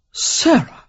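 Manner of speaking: high startled cry of her name; shocked exclamation. Acted. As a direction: gasp her name in disbelief. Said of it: "he exclaimed".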